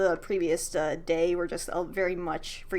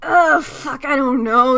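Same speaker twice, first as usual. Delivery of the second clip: flustered exclamation; 5 semitones higher, 11 dB louder, slower than usual. Real, not acted. GM caught out, flustered.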